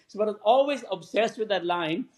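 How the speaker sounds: ordinary talk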